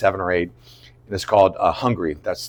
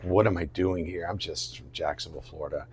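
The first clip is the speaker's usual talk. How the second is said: low, soft, sinking inner voice; self-doubting murmur; 5 semitones lower, 12 dB quieter than usual. Retold or imitated. Actor doubting he belongs on set, murmuring. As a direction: let it sink down quietly. Voicing impostor syndrome to himself.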